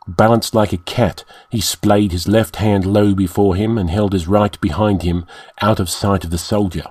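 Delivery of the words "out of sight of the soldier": ordinary delivery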